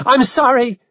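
high, pleading cry; screamed. A narrator's reading. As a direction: cry out a desperate apology. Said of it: "You screamed and screamed".

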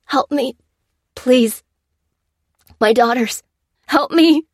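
high, loud desperate begging; tearful pleading. Acted. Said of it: "cried the man, tears in his eyes".

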